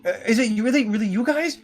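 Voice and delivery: high-pitched, stammering disbelief; flabbergasted. Acted. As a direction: gasp it out in awed amazement. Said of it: "looks, like, just flabbergasted".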